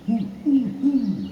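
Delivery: high, loud hooting like an owl; owl hoot imitation. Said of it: "they call him in Ojibwe".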